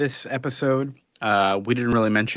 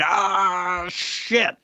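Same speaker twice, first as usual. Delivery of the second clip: higher-pitched mock groan of defeat; a caught hero cursing his luck, voiced lightly; light comic "ah, shit" as if hitting a wall; frustrated groan; 8 semitones higher than usual. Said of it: "He's like".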